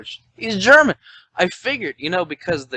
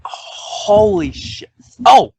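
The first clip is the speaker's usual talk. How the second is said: amazed; 9 semitones higher, 8 dB louder, much slower than usual. Real, not acted. Astonished at the bad writing.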